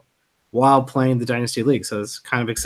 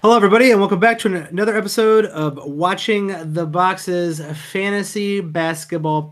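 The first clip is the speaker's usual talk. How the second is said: upbeat announcer opening, fast; podcast host intro; 4 semitones higher than usual. Acted. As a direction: launch the show with energy.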